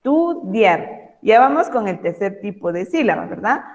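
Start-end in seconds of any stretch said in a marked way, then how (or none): 0.0-1.2 estudiar said slowly again, syllables apart; slow syllable demo. Shown as a demonstration. Teacher to pupils, repeating the word slowly. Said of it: "Primero está la vocal y después la consonante".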